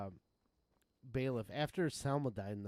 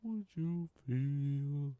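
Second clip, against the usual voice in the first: slow reading; 3 dB louder, much slower than usual. Real, not acted. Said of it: "reading game text aloud".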